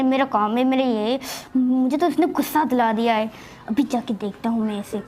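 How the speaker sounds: low grumble of an irritated husband; grumbling husband. Acted.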